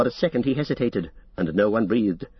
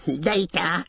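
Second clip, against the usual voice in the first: crying out; 6 semitones higher than usual. Acted. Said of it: "he cried".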